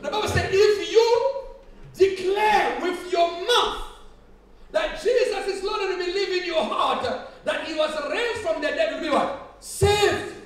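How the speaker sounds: loud, high, drawn-out scripture proclamation; full-force preaching. Real, not acted. Preacher quotes scripture to the congregation, shouted preaching. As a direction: build to a shouted final word.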